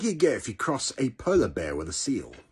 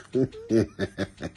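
corny dad laugh. Real, not acted. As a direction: chuckle at own pun. Laughing at own joke.

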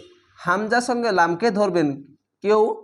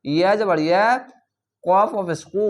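ordinary talk, nothing marked

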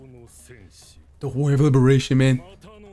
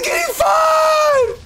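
high-pitched, exhilarated yell; gleeful shout; 14 semitones higher, much slower than usual. Real, not acted. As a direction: shriek it with delight. Delighted, echoing the character.

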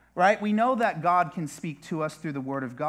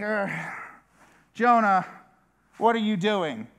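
fed-up groan then louder rebuke; exasperated captain; 5 semitones higher, 3 dB louder than usual. Acted. Captain standing over sleeping Jonah, exasperated and loud. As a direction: sigh at him, then demand an answer. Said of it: "he's standing here and he's like".

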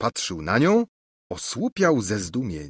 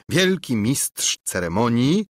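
plain reading voice

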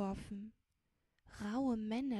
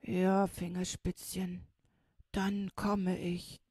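louder, very slow, solemn voice; slow companion; 7 dB louder, slower than usual. A narrator's reading. Big companion promises to come when called, slow and solemn. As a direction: promise it with drawn-out, weighty words.

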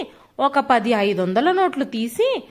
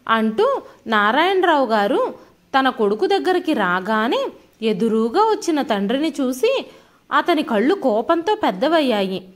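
usual speaking voice

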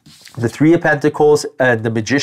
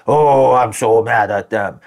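drawn-out, hammed-up annoyance; mock mad; 2 semitones lower, slower than usual. Driver reacting to being cut off, mock mad. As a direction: stretch out a put-on angry groan of words. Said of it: "I get mad".

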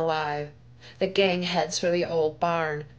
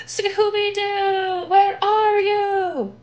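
much higher, slow crying call; desperate wail; 13 semitones higher, 4 dB louder, much slower than usual. Acted. Shaggy surrounded by scarecrows calling for Scooby, a high crying wail. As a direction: wail for your friend in terror.

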